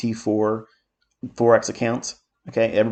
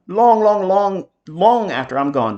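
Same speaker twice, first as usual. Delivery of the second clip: "Long, long, long" drawn out, high and loud; emphatic repetition; 8 semitones higher, 5 dB louder, slower than usual. Real, not acted. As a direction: stretch and punch each "long", pitched up. Emphatic repetition of his boast.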